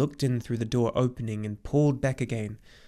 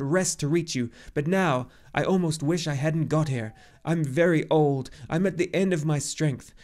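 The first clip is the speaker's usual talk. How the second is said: loud, high, agitated tirade; indignant cry; 6 semitones higher, 5 dB louder than usual. A narrator's reading. Old centaur urging the boy to decide at once, indignant and overwrought. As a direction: press urgently, peaking then tiring slightly. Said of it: "cried Chiron indignantly".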